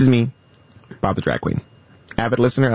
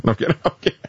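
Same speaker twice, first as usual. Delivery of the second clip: ordinary talk, nothing marked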